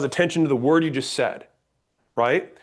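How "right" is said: normal talking voice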